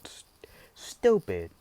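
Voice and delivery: lightly voiced old lady scolding; a hint of a cranky granny. Shown as a demonstration. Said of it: "in a old lady voice".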